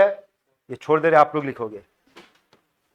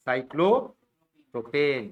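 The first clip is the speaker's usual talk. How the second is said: drawn-out prompt; 4 semitones lower, much slower than usual. Shown as a demonstration. Teacher drags out the answer.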